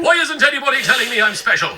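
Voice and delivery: high, loud, rushed whine; petulant high cry for attention; whiny outburst. Acted. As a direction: blurt it out fast and needy. Whining for attention.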